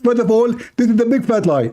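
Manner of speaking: retelling a debate opponent's words in a mocking high voice; mocking Zakir Naik. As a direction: squeeze the voice up high to mock the opponent. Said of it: "he always call me".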